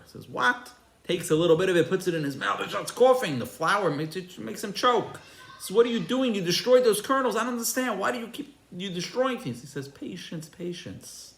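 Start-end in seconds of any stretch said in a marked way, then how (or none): 0.3-0.7 high-pitched, disbelieving what; incredulous. Retold or imitated. Visitor told the powder is flour, incredulous. 5.8-9.6 high, agitated questioning; bewildered protest. Retold or imitated. Visitor to farmer who ground the kernels, bewildered protest. 9.9-11.3 much softer, calming counsel; hushed reassurance. Retold or imitated. Farmer to protesting visitor, hushed and patient.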